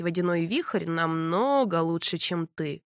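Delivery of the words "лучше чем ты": низкий медленный голос вампира; лениво, свысока, как вампир. A narrator's reading. Vampire's low voice. (низкий голос вампира)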